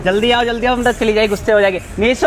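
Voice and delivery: loud, high calling across the park; loud pleading call to come. Real, not acted. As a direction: call her over urgently. Calling her over loudly.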